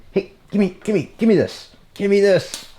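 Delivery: high, coaxing voice to an animal; coaxing pet voice. Real, not acted. Owner coaxing his sheep to give back chewed hardware, high coaxing voice. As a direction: coax the sheep to hand it over.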